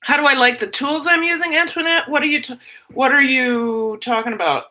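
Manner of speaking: ordinary talk, nothing marked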